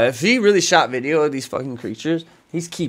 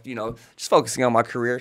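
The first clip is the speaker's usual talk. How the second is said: normal talking voice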